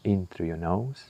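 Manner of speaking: guided meditation. Acted. Guiding the listener through breath and body scan, calm meditation voice. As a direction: ease the listener inward, unhurried.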